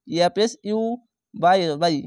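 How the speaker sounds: ordinary talk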